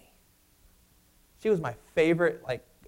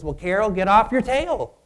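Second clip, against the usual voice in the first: loud, high scolding; sharp stern order to get moving; stern rebuke; 6 semitones higher, 10 dB louder than usual. Retold or imitated. Pastor voicing God scolding lazy Carol, stern shout. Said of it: "I believe he says".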